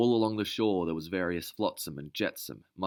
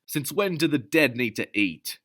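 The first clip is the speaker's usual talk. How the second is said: high, loud, mocking question; scornful scoff; 8 semitones higher, 7 dB louder than usual. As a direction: sneer at her claim. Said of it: "Elvin scoffed".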